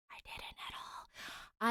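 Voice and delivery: sheepish whispered admission; whispered aside. Real, not acted. Host admitting her mic error, whispered aside. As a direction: whisper it like a guilty confession. Confessing own mistake aside.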